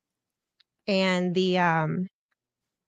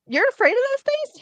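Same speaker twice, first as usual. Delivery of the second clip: incredulous question; 3 dB louder than usual. Retold or imitated. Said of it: "I'm like".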